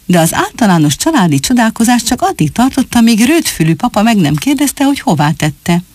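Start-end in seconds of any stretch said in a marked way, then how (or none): none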